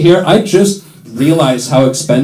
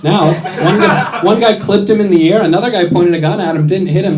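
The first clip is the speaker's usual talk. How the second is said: ordinary talk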